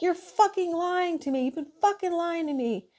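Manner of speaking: a high, enraged retold rant; imitating angry yelling patient. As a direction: play the furious patient accusing her. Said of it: "he was started fucking yelling at me".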